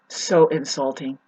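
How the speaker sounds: usual speaking voice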